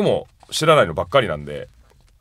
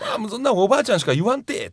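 imitating Hina-chan; 11 semitones higher than usual. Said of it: "とかって言いそう".

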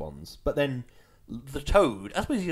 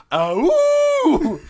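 high, loud mock toy voice; cartoon European toy voice, high and loud; mock speak and spell cow; 13 semitones higher, 11 dB louder than usual. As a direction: squawk it like a toy. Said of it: "The cow goes".

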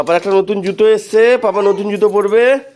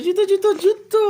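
higher, bouncing repeated calls; playful high call; 13 semitones higher than usual. Real, not acted. Parent luring toddler to new shoes, playful high call. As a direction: sing the word জুতো up high to tempt him.

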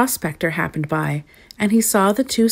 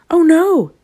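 high, loud cry of dismay; alarmed shout; 11 semitones higher, 7 dB louder than usual. A narrator's reading. Gnome seeing his beard smolder, shouting in alarm. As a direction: shout it high as disaster strikes. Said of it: "he shouted".

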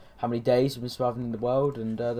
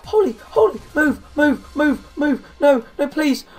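panicked high yelling; shouting orders over battle; 12 semitones higher, 10 dB louder than usual. Real, not acted. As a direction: scream commands as zombies swarm. Zombie attack panic.

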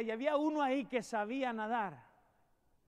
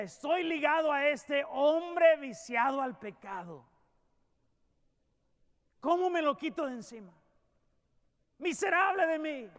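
slow, raised anguished cry; Paul crying out in despair; Paul's lament; 3 semitones higher, 4 dB louder than usual. Acted. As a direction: plead as a man chained to sin. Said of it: "Decía".

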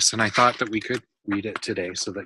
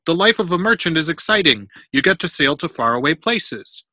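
higher, lively, emphatic pitch; persuasive enthusiasm; 6 semitones higher, 6 dB louder than usual. A narrator's reading. Father selling his son on merchant life, enthusiastic. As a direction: talk it up as an adventure.

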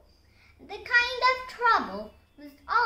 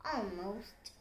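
ordinary delivery

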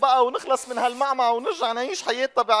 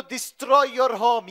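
put-on Arabic-accented English; much slower than usual. Slow literal English.

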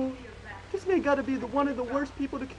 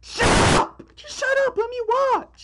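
mock angry shout; 10 semitones higher, 7 dB louder than usual. Annoyed at a speech before the play.

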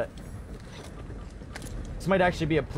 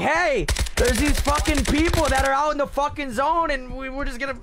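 hyped gamer bro; 9 semitones higher, 4 dB louder than usual. Retold or imitated. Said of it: "everybody's like".

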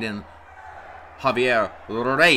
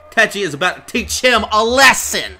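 high, loud ringside call; excited hype; 7 semitones higher, 5 dB louder than usual. Real, not acted. Commentator announcing Tetchy's coming lesson, excited. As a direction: build eagerly toward the payback.

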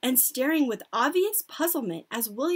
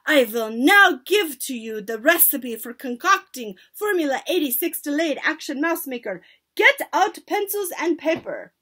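loud, higher proclamation; shouted; 5 semitones higher, 9 dB louder than usual. A narrator's reading. Grand High Witch dictating her recipe, shouted. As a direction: proclaim it to a full hall. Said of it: "Grand High Witch was shouting".

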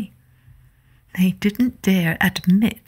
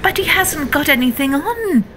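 crying out; 8 semitones higher than usual. Acted. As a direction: call it out for all to hear. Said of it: "the whole town cried out".

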